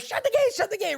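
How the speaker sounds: very high, winded mock order; out of breath. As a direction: act out gasping an urgent command. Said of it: "like, I'm out of breath".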